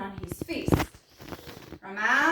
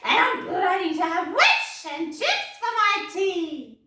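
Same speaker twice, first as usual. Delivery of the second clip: menacing dragon; 5 semitones higher, 7 dB louder than usual. A narrator's reading. Dragon threatens to eat the witch. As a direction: gloat like a hungry dragon.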